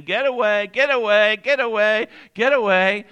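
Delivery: loud panicked repeated get away; mock the wife's frightened cry; panicked shout of get away; retold nightmare scream. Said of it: "she's screaming at the top of her lungs".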